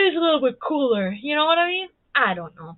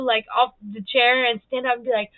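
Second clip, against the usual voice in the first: ordinary talk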